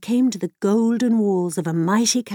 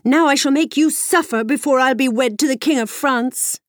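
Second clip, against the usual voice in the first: angry princess; 6 semitones higher, 3 dB louder than usual. A narrator's reading. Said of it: "with anger".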